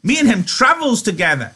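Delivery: very high-pitched desperate insistence; high, frantic protest. A narrator's reading. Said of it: "Lenny cried frantically".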